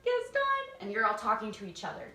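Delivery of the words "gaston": very high, loud disgusted whine; shrill mock disgust; grossed out. Shown as a demonstration. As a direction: act sickened and over the top. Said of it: "but just act grossed out".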